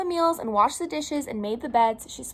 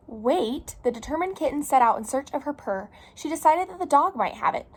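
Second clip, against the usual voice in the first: animated picture-book reading, lilting pitch; storytime read-aloud; 4 dB louder than usual. Acted. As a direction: read it to little children, lively and clear. Reads picture book to children.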